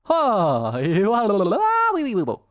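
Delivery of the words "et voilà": ordinary talk, nothing marked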